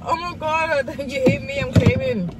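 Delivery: very high-pitched mock outrage; acting the crash victim. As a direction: play up a fake injury claim in a high voice. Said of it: "I'm that person where I'll be like".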